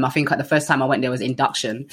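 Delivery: ordinary talk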